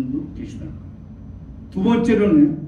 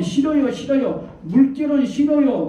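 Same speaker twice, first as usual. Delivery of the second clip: higher-pitched mock protest; protesting grandmothers; 8 semitones higher than usual. Grandmothers rejecting God as a wave, protesting. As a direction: refuse it like a pleading granny.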